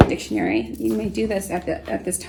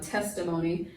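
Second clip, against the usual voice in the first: ordinary talk, nothing marked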